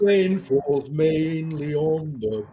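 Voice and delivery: slow, careful elocution; slow posh elocution. Retold or imitated. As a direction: enunciate it slowly like Eliza. Quoting My Fair Lady line.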